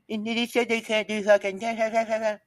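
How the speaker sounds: mock his excuse in a high sing-song; mocking whine. Mocking the other third's lame excuse, high sing-song.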